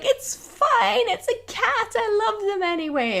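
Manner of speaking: high-pitched, gushing reassurance; besotted cat lady. Retold or imitated. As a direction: dismiss it in a high, doting sing-song. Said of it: "me being a cat lady".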